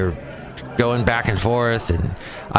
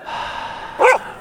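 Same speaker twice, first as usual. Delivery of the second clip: high, loud exasperated sigh; huge fed-up sigh. Real, not acted. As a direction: let out a big fed-up sigh. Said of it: "That was loud".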